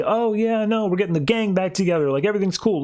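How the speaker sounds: high-pitched, breezy mock cheer; light and fluffy. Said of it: "light and fluffy".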